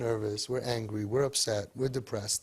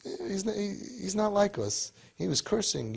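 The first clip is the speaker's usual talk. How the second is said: lightly voiced teens, higher; imitating the teens; 6 semitones higher than usual. Rabbi quoting the teens about the volunteer, higher mocking voice. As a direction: mimic the kids complaining, a bit higher. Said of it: "they were telling me".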